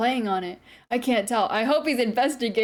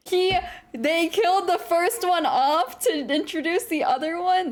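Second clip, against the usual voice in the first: incredulous; 6 semitones higher than usual. Real, not acted. Streamer reacts to a second boyfriend being introduced, incredulous. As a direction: stretch it out high in scandalised amazement.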